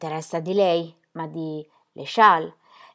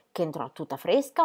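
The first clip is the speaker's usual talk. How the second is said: ordinary delivery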